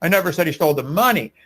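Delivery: "money" stressed at the very end. Shown as a demonstration. Debater shows how emphasis changes meaning, stress on "money". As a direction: save the punch for "money". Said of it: "By just emphasizing different words".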